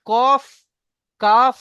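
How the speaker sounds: কাফ ending in a breathy f, air through the lips; correct f ending. Shown as a demonstration. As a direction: let the air pass on কফ and কাফ. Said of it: "মুখ গোল করে উচ্চারণ হবে". (ঠোঁটের ফাঁক দিয়ে হাওয়া দিয়ে ফ)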